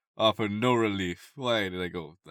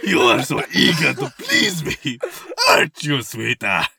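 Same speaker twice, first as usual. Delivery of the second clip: louder, giggling mock seduction; laughing through it; 5 semitones higher, 9 dB louder than usual. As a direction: read the line while cracking up. Rereading line through laughter.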